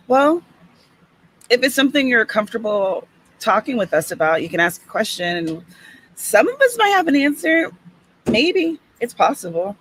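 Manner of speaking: ordinary talk, nothing marked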